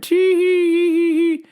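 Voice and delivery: high mock tee-hee, drawn out slow; giggle. Worker mimicking customer's giggle, high and slow.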